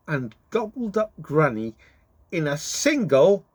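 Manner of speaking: ordinary delivery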